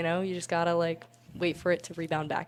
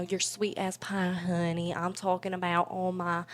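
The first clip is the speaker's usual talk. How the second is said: slow, sugary Southern drawl; Southern accent; slower than usual. Shown as a demonstration. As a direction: stretch the vowels like a Southern belle. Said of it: "my character talked in a Southern accent".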